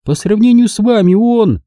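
high-pitched hurt society lady; Mrs Kane, high and offended. A narrator's reading. Mrs Kane to Engel, high and injured. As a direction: she sounds wounded that no one thanks her. Margo, cut off.

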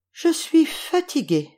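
put-on high, slow, tired little-girl voice; storybook tiredness, high and slow; weary sigh. A narrator's reading. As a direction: sigh it out wearily, drawn out. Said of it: "soupira Boucle d'Or".

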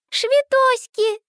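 put-on high, slow little child lisping; toddler voice. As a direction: coo it like a tiny girl. Toddler Minnie's lisped word.

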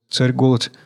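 usual speaking voice

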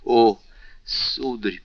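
plain reading voice

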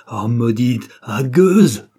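rising anguished outcry at the rival; cursing the painting in pain; cry of suffering. Acted. Wife cries out at husband in anguish. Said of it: "ce grand cri de souffrance".

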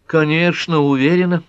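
high-pitched, agitated assurance; trembling reply. A narrator's reading. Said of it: "дрожащим голосом ответила Пэт".

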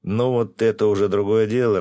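amused. A narrator's reading. Trader pleased she will make a wig, hearty amusement. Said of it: "рассмеялся торговец".

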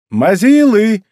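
high, loud jeer; shouted exclamation. A narrator's reading. Казуки jeers at throwers across the field, shouting. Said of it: "услышали они возглас Казуки".

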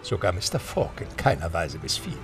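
ordinary talk

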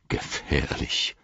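slow, hushed, low repeat; ominous echo. A narrator's reading. Gandalf takes up Gimli's word dangerous, ominously hushed. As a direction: draw the word out softly and darkly. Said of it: "rief Gandalf".

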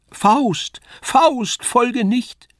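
very high, clear angel voice; fine angel voice. A narrator's reading. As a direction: plead gently from on high. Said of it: "eine andere feine Stimme". (feine, hohe Engelsstimme)

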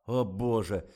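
very loud, fed-up tirade; blustering, irate outburst; exasperated rant. A narrator's reading. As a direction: rail loudly at the whole lot. Boss rants at the actress.

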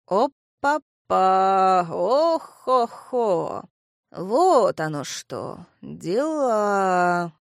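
slow, drawn-out amazed exclamations; old man marvelling, stretching it out; surprised old man. A narrator's reading. Grandfather reacts in amazement.

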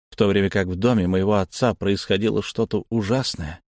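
ordinary delivery, nothing marked

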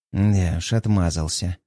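low, reluctant grumble; grumbling. A narrator's reading. Wife grudgingly agreeing with husband, low grumble. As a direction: grumble as you give in. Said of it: "бурчит она".

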